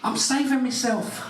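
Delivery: high, rising squeal of indignation; outraged girl voice. Retold or imitated. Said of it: "She's like".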